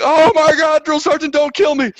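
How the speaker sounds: high loud terrified squeal. Retold or imitated. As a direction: beg for your life in a squeak. Terrified recruit reply retold.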